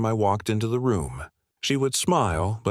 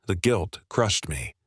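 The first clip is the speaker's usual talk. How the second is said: ordinary delivery, nothing marked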